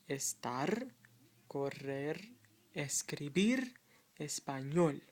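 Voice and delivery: "Estar, correr, escribir, español" each stressed on its final syllable; stress on last syllables. Shown as a demonstration. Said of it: "emphasis of the word on the last part".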